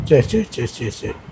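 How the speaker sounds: low, soft repeated hushing; murmured reassurance; soothing. Real, not acted. Calming down after the rough play.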